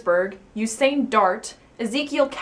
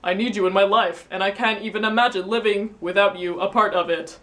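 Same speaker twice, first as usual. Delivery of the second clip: louder, mock-anguished pleading; hammed-up heartbroken begging; crying painfully; 4 dB louder than usual. Ex-boyfriend begging Doodle back, mock anguish. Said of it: "Usain cried painfully".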